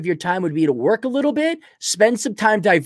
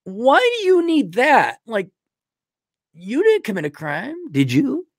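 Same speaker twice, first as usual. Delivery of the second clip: suspicious question; 6 semitones higher than usual. Retold or imitated. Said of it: "I'm like".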